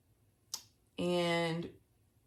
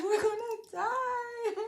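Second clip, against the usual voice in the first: high, slow wail half laughing; laughing panic wail; slower than usual. Retold or imitated. Telling sister on the jet ski they will die, high laughing wail. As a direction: wail it like you're doomed but can't stop laughing. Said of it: "I was like".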